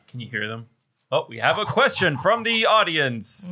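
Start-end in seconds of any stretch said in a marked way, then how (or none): 1.1-3.5 mock announcer.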